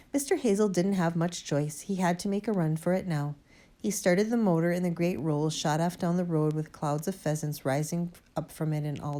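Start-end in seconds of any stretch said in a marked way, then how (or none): none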